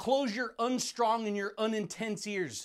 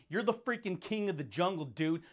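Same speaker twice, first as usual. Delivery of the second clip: ordinary talk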